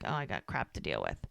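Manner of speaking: very low, soft imitated mutter; retold grumbling aside. Walking out on the boss, muttered mock grumble. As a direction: grumble it under your breath as his private thought. Voices his grudging thought while leaving.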